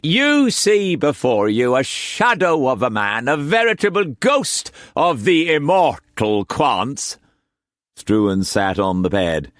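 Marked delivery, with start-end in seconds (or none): 0.0-7.2 high, theatrical swooning voice; high, hammed-up invalid gasping his last; melodramatic faintness. A narrator's reading. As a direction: ham up the dying man. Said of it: "roared with laughter, then grabbed his chest".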